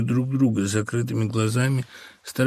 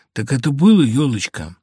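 ordinary delivery

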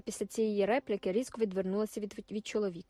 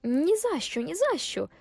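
higher and louder, pushing it away twice; high, insistent refusal; 7 semitones higher, 8 dB louder, slower than usual. A narrator's reading. Wife refusing to go near the racecourse, high and insistent. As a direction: refuse with horror, voice rising.

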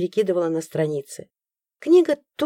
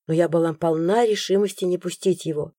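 ordinary delivery, nothing marked

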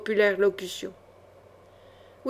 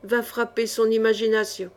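plain reading voice

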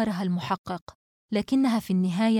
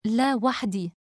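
usual speaking voice

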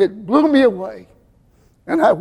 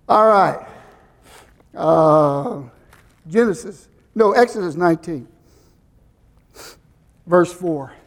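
normal talking voice

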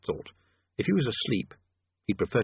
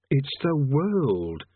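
very slow, higher, patient explaining; 4 semitones higher, much slower than usual. A narrator's reading. Rincewind explaining to the boy, slow and patient. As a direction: spell it out slowly as if to a child. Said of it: "he said patiently".

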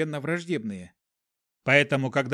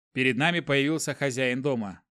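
plain reading voice

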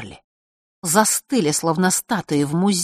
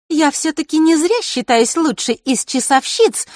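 put-on high, proud girl's voice; higher haughty girlish tone; boastful girl; 10 semitones higher, 6 dB louder than usual. A narrator's reading. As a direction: boast of being the best. Маришка's held voice.

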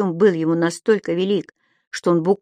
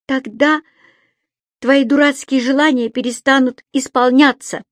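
high, louder defiant retort; cocky; 6 semitones higher, 4 dB louder than usual. A narrator's reading. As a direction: fire it back cheekily. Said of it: "ответил задиристо".

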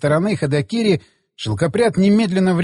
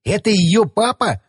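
high-pitched disbelief, falling at the end; flabbergasted, pitched up; shocked; 4 semitones higher than usual. A narrator's reading. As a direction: say it as stunned realisation. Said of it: "выдавил шокированный Ходокири".

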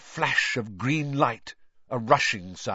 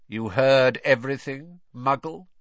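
cold, louder questioning; 4 semitones higher, 6 dB louder than usual. A narrator's reading. Unseen master questions the eavesdropper, coldly. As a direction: challenge him coldly. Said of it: "said the cold voice".

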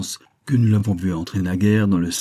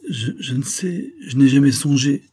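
put-on stammering girl; 6 semitones higher, 4 dB louder than usual. A narrator's reading. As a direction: falter on "je, je" and blurt the rest. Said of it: "murmura-t-elle".

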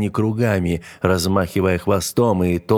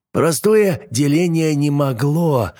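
mad scientist; 8 semitones higher, 3 dB louder, slower than usual. Acted. Scientist denies causing disaster.

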